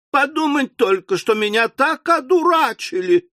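put-on high, outraged wail of a young daughter; exclaiming. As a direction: cry out that she's been fooled. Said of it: "воскликнула мисс Напкинс".

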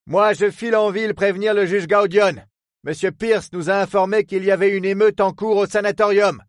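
high, firm voiced captain giving orders; commanding captain. Captain ordering the superintendent to care for Pierce. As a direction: rap out the orders briskly.

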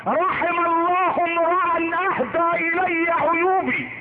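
drawn-out, high-pitched proclamation; declaimed inscription. Acted. Preacher reveals the inscription on Omar's door, declaimed. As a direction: intone it high and slow, stretching the words. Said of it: "لا والله كاتب كده".

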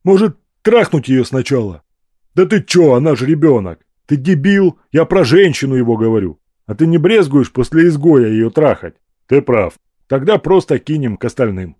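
loud, coarse bandit talk; rough thug voices. Acted. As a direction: growl it like a brutish gang thug. Thugs talking over captives. (грубые голоса бандитов)

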